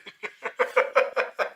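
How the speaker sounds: high, breathy laughter. Real, not acted. Narrator laughing high at the memory. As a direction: let the laugh ring out high.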